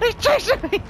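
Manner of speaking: terrified shriek. Real, not acted. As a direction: shriek as he appears. Monster appears.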